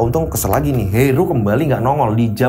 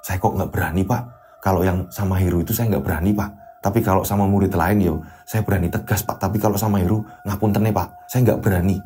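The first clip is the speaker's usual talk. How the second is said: timid, scared counselor; 8 semitones lower, 5 dB quieter than usual. Acted. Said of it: "Pak Kus ini ketakutan".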